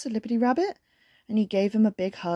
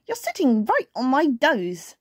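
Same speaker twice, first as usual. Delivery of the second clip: squashed, higher story voice; gasping; 4 semitones higher than usual. A narrator's reading. Squashed bear complains to rabbit, mildly gasping. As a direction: strain it out, acted. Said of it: "gasped Little Brown Bear".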